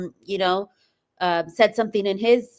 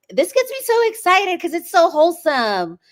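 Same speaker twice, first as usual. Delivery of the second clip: high, choked-up excited voice, a bit louder; overwhelmed with joy, voice rising high; emotional excitement; 5 semitones higher, 4 dB louder than usual. Real, not acted. Host moved while reading a supporter's letter, high emotional voice. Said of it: "I'm getting emotional".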